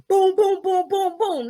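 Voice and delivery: bang it out like a fist on the door; imitated knocking. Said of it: "Mommy was like".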